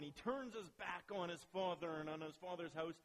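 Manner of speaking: ordinary talk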